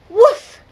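a small dog barking loudly; high loud woof. Acted. The dog barks along with the cats, high and loud.

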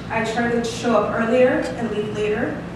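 ordinary talk, nothing marked

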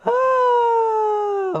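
sudden very high squeal. Real, not acted. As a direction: squeak it out in shock.

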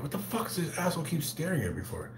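high, hushed, exasperated complaint; under-the-breath, high-pitched disbelief; annoyed bafflement. Retold or imitated. Acts out his annoyance at staring.

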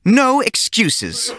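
teacher refusing every excuse, slowly; slow firm teacher. A narrator's reading. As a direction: drag out the verdict like a witch.

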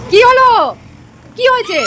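high, loud startled question. Real, not acted. Baby suddenly screams.

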